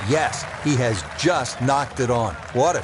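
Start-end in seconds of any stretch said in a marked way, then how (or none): none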